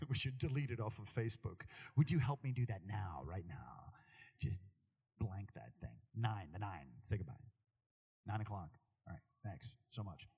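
low, hushed pretend side request; low-pitched, playful undertone; mock confidential aside. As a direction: drop low as if asking a discreet favor. Mock aside asking for deletion.

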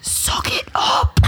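harsh whispered order. Retold or imitated. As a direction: hiss it under your breath, half laughing. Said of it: "'Cause it was a whisper".

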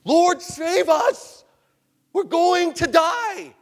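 high, loud drawn-out cry for help; desperate, long-held high shout; screaming in panic. Acted. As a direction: scream it slowly as if drowning. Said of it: "probably screaming".

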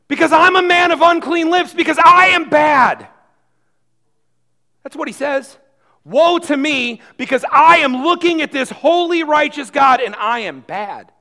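loud, high, desperate confession; anguished confession. Acted. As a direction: plead it out in despair. Voicing Isaiah's confession loudly.

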